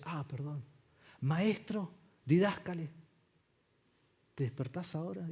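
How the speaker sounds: soft, unhurried mock apology; gently nudging, no urgency; mock polite quiet. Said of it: "en voz baja". (disculpa suave y burlona)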